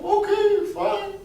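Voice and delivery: very high carefree singsong; acted-out blissful acceptance; happy, smiling. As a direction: chirp it cheerfully, untroubled. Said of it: "He's happy, smiling".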